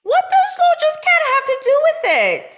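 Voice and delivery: loud, high, defensive yelp; defensive outburst. Acted. Defensive outburst when caught lying.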